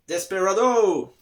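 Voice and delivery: loud, high, drawn-out title shout; showy announcement. Pretend. Vlogger revealing the next film to viewers, showy shout. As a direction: belt out the film title with gusto.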